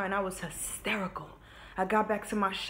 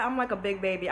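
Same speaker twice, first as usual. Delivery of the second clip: ordinary talk, nothing marked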